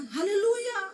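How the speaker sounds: loud, high hallelujah as if quarreling; hammed-up quarrelsome shout; mock quarrel shout. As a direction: shout praise like you are arguing. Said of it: "kalau ribut ngomongnya".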